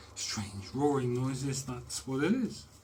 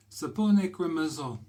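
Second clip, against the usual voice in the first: hammed-up Italian accent on the soap name; 4 semitones higher, 5 dB louder than usual. Showing viewers the soap, in a mock Italian accent. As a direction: read the Italian label in a put-on Italian accent. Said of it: "that is the best Italian accent I can do".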